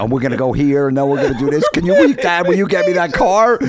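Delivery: put-on high, loud, excited kid voices; family thrilled about a fake jackpot. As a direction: burst out with plans and wishes. Kids excited over fake lottery win.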